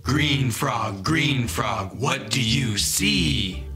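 ordinary delivery

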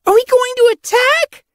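very high, loud excited question; eager, bouncing. A narrator's reading. Apprentice bouncing for a battle, excited. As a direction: beg for action, high-pitched. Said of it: "bouncing on her paws".

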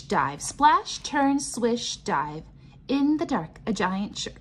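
plain reading voice

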